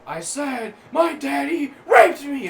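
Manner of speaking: high, very loud, drawn-out yell; screaming. Retold or imitated. Coworker repeats it to the stunned shop, screaming. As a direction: imitate a coworker bellowing it slowly. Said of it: "And he just starts".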